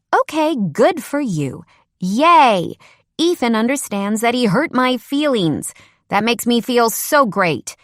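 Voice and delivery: high-pitched mock cheer; sarcastic. A narrator's reading. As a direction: gush with fake delight. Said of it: "There's no need to be sarcastic".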